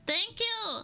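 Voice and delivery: high, loud, thrilled thank you; excited. Real, not acted. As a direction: shout your thanks with joy. Cheering when an item appears.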